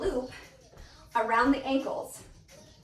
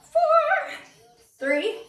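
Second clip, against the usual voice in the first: shouted count; 13 semitones higher, 4 dB louder, much slower than usual. Real, not acted. Counting down final reps.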